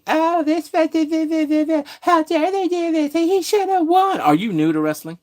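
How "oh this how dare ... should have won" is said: high, slow whiny fan voice mocking complainers. Mocking complaining fans to the viewers, in a high whiny voice. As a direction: whine it out like a sore fan. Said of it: "stupid marks that were complaining".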